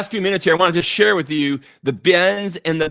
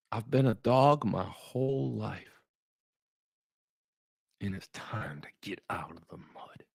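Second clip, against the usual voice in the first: barely above a hush, heavy with feeling; quiet quote; 8 semitones lower, 9 dB quieter than usual. Retold or imitated. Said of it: "That's how I say it".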